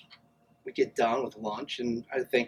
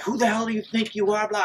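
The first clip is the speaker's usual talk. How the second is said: high-pitched imitated indignation; pissed-off coach; 10 semitones higher than usual. Famous coach confronting the man at the pool, pissed off. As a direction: snap like an offended coach, mockingly. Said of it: "is pissed".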